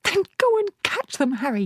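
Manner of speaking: very high, urgent push; a mother pushing her husband to act; bossy hiss. A narrator's reading. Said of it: "hissed the mother".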